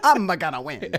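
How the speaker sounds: loud, high mock-Italian boast, laughing; cartoon Italian racer. Imitating Mario Kart racers, mock Italian accent. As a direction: crow it like a video game hero. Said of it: "playing Mario Kart".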